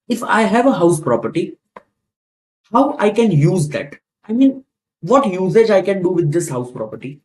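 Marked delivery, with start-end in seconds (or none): none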